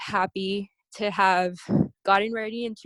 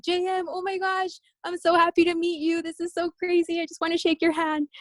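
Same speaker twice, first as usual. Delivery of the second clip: high, gushing and breathless with excitement; starstruck fan; 8 semitones higher than usual. Retold or imitated. Athlete meeting her idol, high starstruck gushing. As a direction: gush at your idol.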